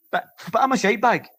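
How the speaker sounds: ordinary talk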